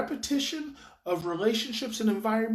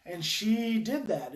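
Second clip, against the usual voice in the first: ordinary talk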